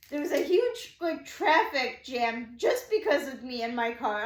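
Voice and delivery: mildly frantic retelling; retold freak-out. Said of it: "I'm, like, freaking out".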